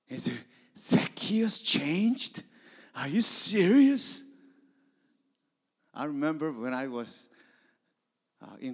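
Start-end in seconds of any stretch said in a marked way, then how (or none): 0.8-4.2 high, loud astonished cry; can't believe the news; incredulous shout; amazed disbelief. Acted. Matthew astonished at Zacchaeus' change.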